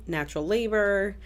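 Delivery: ordinary talk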